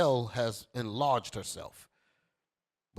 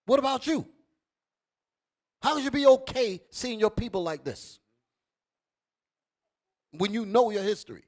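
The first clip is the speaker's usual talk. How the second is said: louder, higher, pressing challenge; fiery preaching; 9 semitones higher, 6 dB louder than usual. Acted. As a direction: hammer the question at the crowd. Preaching at full force.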